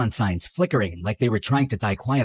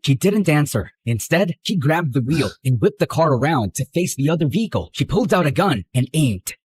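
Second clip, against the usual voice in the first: normal talking voice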